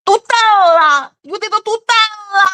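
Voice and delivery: high, stretched-out stupid-sounding parody; mocking bad French speakers. Mocking learners who sound ignorant, high dopey imitation. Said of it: "You sound so fucking stupid".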